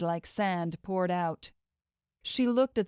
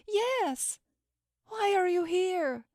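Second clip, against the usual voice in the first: tearful; 13 semitones higher, slower than usual. Acted. Crying over fallen friend.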